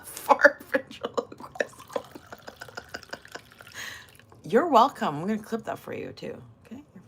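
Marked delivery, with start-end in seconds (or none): 0.0-2.0 slow, voiceless words through laughter; cracking up, barely voiced; laughing. Real, not acted. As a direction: wheeze it out while laughing. Laughing at the joke.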